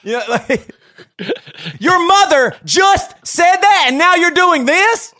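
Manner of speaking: high, loud, slow incredulous shout; father in shock at his kids' misbehavior; shocked exasperation. Shown as a demonstration. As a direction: sound stunned they did something so dumb. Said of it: "in shock that they would do something".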